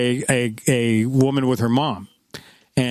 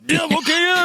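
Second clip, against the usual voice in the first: stoned cop. Retold or imitated. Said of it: "he's like".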